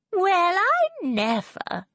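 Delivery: high, slow, affronted exclamation; perplexed. A narrator's reading. Mother stares after the departing lord, high perplexed exclamation. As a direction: draw it out high in astonishment.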